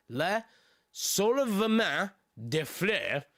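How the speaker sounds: slow, deliberate French name, word by word; measured second attempt at "Le Soulèvement des Fleuves"; careful retake. Real, not acted. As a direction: spell out "Le Soulèvement des Fleuves" slowly with pauses.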